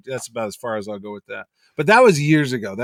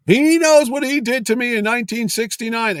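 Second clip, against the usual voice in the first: mock outrage, pitched up sharply; retold angry outburst; 9 semitones higher, 6 dB louder than usual. Artist refusing the panel over an old grudge, acted angry outburst. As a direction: imitate an indignant artist, high and loud.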